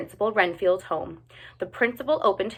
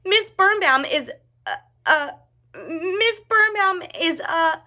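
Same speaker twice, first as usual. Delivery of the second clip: much higher, stammering panic rising to a shriek; screaming in fright; 12 semitones higher, 3 dB louder than usual. A narrator's reading. Cheryl spotting the giant teacher in terror. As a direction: stutter in terror, then scream. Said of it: "screamed Cheryl Schumacher".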